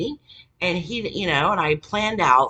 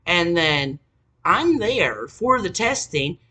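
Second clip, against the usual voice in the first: ordinary talk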